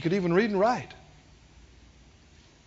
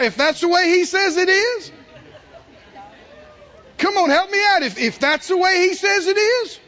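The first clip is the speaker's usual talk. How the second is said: raised, high-pitched pulpit exhortation; forceful preaching; 8 semitones higher, 5 dB louder than usual. Real, not acted. Preacher telling congregation it's hard on pride, forceful preaching. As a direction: drive it home to the congregation, loud and insistent.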